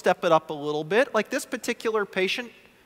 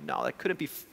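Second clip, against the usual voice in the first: ordinary talk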